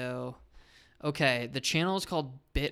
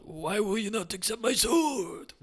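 put-on higher, louder caricatured Japanese accent; hammed-up Japanese accent, pitched high; mock Japanese accent; 8 semitones higher, 5 dB louder than usual. Friend acting out the sword presentation, mock Japanese accent. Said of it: "Quick, racist Japanese man accent".

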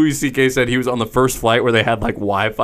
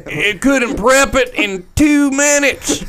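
mocking whiny customer; 13 semitones higher, 4 dB louder, slower than usual. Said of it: "everyone's like".

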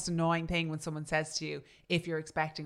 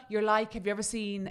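ordinary delivery

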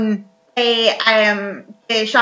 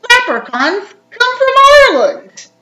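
slow, high and loud announcer tone; narrator voice; 13 semitones higher, 8 dB louder than usual. Acted. Mocking the game narrator with a high, loud, slow voice. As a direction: boom it out like a cheesy CD-ROM narrator.

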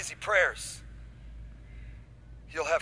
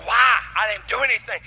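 loud, indignant mock protest; acted aggrieved kid; 5 semitones higher, 12 dB louder than usual. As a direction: protest innocence, punching the first word. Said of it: "that's how they walk in feeling".

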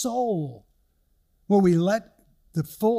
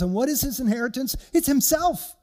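fervent climax; 7 semitones higher than usual. Real, not acted. Preaching climax.

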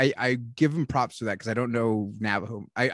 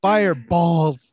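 drawn-out mock; 4 semitones higher, 8 dB louder, much slower than usual. Drawn-out mocking repeat.

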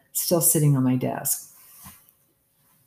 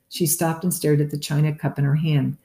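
ordinary delivery

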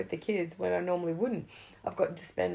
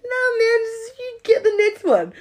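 much higher and louder, carefree; loud breezy refusal; 15 dB louder than usual. Retold or imitated. Refusing a friend's money, loud and carefree. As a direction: wave it off big and generous.

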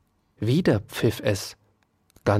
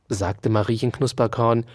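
ordinary delivery

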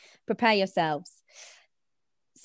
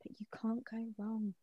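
whispering. Real, not acted. Whispered punchline.

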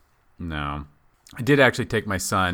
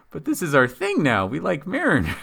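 put-on confused kid; 9 semitones higher, 4 dB louder than usual. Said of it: "my son was confused".

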